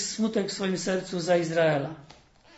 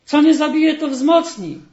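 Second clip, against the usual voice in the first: loud, high, blustering slogan; mock bravado; 8 semitones higher, 12 dB louder than usual. Sadness mimicking people's tough slogan, loud mock bravado. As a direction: parrot the tough saying mockingly. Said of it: "Mówią".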